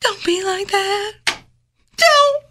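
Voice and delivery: slow, high, melodramatic begging; hammed-up pleading. Co-host begging Nikki as a friend, hammed-up pleading. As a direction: plead in drawn-out fragments, overdoing the hurt.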